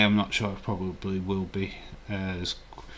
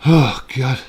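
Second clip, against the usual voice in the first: exasperated groan; 4 semitones higher, 13 dB louder than usual. Real, not acted. Reacting to stream schedule.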